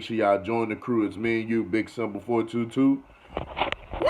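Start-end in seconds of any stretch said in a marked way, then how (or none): 4.0-4.1 loud, high, drawn-out whee; whooping sign-off yell; high-energy whee. Real, not acted. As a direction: let out a long, excited holler. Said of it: "I gotta give some energy in it".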